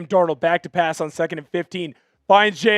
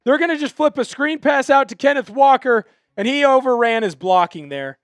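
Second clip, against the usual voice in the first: live play-by-play; 6 semitones higher, 5 dB louder than usual. Acted.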